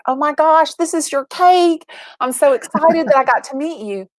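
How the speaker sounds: gushing, high-pitched retold delight; excited greeting. Host retelling meeting the boy for his cake, excited. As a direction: gush with delight, pitch jumping way up.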